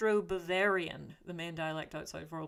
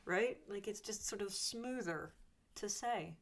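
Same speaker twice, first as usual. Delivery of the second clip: ordinary delivery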